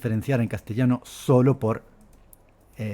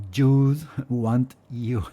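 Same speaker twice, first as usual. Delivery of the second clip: put-on Spanish accent in English; much slower than usual. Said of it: "puedo imaginar a un español diciendo".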